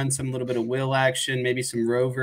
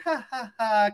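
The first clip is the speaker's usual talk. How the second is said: mock goofy chuckle, pitched higher; Jack Collinsworth laugh; 6 semitones higher, slower than usual. Podcaster mocking announcer's laugh, higher mock chuckle. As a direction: poke fun with a hammed-up booth laugh. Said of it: "Jack Collinsworth is always like".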